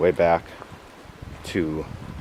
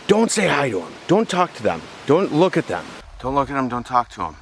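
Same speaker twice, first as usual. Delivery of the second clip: higher, insistent don'ts, clipped commands; imitating a bossy leader; 6 semitones higher than usual. Leader warning the hiker off the kids, mock bossy. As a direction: lay down strict rules in a mock-bossy voice.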